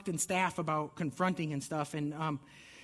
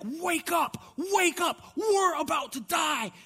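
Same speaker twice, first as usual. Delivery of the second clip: high frantic shout of terrified disciples; desperate yell over the storm; panicked cry; 10 semitones higher, 4 dB louder, much slower than usual. Acted. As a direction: cry out as if the boat is sinking.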